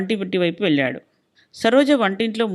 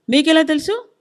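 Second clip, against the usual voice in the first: high-pitched challenging question; raised voice; 8 semitones higher, 4 dB louder than usual. A narrator's reading. Wife challenging her husband's certainty, voice raised. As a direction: voice jumps up before you know it. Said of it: "తనకే తెలియకుండా గొంతు పెంచింది".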